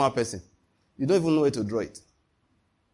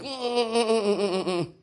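moved humming cry; 7 semitones higher than usual. Retold or imitated. Moved by someone's hug.

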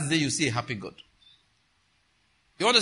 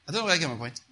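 ordinary talk, nothing marked

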